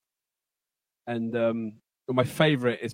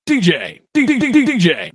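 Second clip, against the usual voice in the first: DJ hype call; 11 semitones higher, 12 dB louder than usual. Acted. DJ stutter call.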